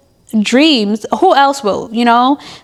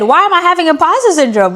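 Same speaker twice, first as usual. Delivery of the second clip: ordinary delivery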